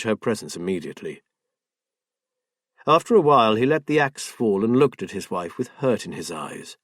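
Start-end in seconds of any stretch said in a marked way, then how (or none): none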